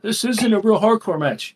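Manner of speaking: mock whiny complaint, pitched high; complaining. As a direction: imitate online gripers in a high voice. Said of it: "a whole lot of complaining on Twitter".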